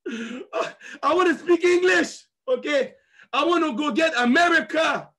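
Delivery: high, drawn-out shouted declaration; hammed-up proclamation. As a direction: holler it slowly, stretching the words. Clame son envie de parler anglais. (proclamation criée, exagérée)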